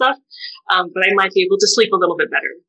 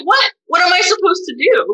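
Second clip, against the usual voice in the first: much higher, exasperated disbelief; baffled outburst; 10 semitones higher than usual. Retold or imitated. Retelling her reaction to the family's mixed messages, exasperated. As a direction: throw up your hands in confusion. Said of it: "And I'm like".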